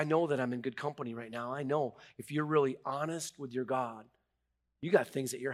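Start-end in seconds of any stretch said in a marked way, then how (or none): none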